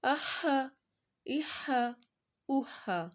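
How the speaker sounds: teacher shows the mid-throat ح to students. Shown as a demonstration. اہا rasped from the middle of the throat. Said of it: "ہا حلق کے درمیان والے".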